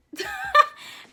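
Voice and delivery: a sudden high squeal of a laugh. Real, not acted. She laughs at her own rambling, squealing high. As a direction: burst into a shrieking giggle. Laugh after photo shoot talk.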